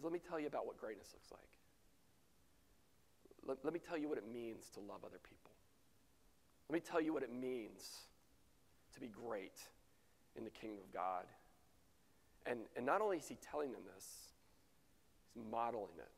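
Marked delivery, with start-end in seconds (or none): 0.0-11.3 much softer, lower, hushed confiding; Jesus confiding to the disciples, hushed; intimate whisper. Acted. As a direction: lean in and whisper the secret.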